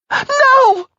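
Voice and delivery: very high loud wail; sobbing cry. Acted. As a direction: wail the word in grief. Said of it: "Jackie echoed, sobbing".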